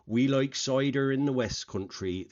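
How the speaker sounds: put-on light West Country accent, cider said like soider, r rolled; host quoting guest in a mild put-on West Country burr. Host quoting guest's line, in a light West Country accent. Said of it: "when they put on the West Country accent".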